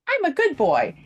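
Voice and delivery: put-on sudden high, proud good-boy cry; good-boy creature. Leaping at the thug's throat, high eager dog voice.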